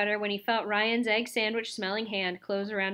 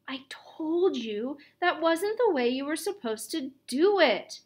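higher, exasperated scolding; disgusted; 5 semitones higher than usual. A narrator's reading. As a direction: scold him with open disgust. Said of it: "He sounded disgusted".

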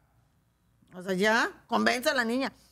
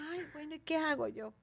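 ordinary talk

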